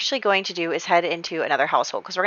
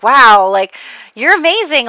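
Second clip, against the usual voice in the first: smitten gushing; 5 semitones higher, 8 dB louder than usual. Retold or imitated.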